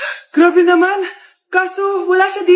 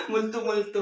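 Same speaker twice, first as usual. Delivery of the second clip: spooky ghost; 7 semitones lower, 10 dB quieter than usual. Acted. Ghost voice teasing the daughter.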